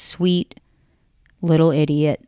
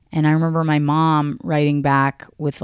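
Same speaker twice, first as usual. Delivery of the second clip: ordinary talk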